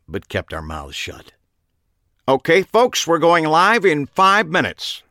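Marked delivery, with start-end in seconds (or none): 2.3-5.0 loud, high call to the audience; projected showman's call; TV host announcement. A narrator's reading. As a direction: announce it out to the whole room. TV host calls to live audience.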